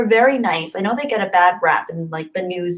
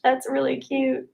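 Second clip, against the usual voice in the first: ordinary talk, nothing marked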